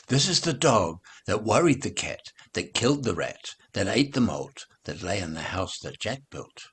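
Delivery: ordinary delivery, nothing marked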